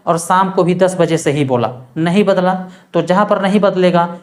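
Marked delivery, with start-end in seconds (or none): none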